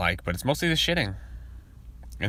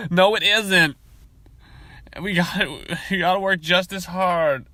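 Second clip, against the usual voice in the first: mock boss voice; 8 semitones higher, 5 dB louder, slower than usual. Mock boss pep talk.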